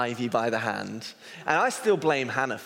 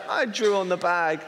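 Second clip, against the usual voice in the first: put-on toddler voice; 6 semitones higher than usual. Toddler confessing, voiced by dad.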